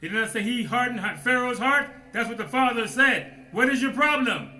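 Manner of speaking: loud, high, slowing on the last words; full-force preaching. Acted. Preacher urging his brethren to fight, loud high preaching. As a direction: preach it up onto the pedestal.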